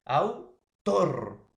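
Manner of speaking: "autor" split in two, loud stretched "tor"; stress on last syllable. Shown as a demonstration. Teacher giving an aguda example, last syllable stressed in "autor". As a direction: punch a long final "tor" in "autor". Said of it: "la mayor fuerza de voz en la última sílaba".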